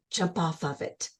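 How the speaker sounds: soft, low voice giving a command; a quiet voice telling her to jump; inner voice. Retold or imitated. A voice in her vision quietly commanding the jump. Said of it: "there was a voice that says".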